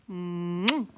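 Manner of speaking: loud smacking air kiss; kiss sound. Real, not acted. Signing off to viewers with a big kiss, loud smack. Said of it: "a big smackaroonie".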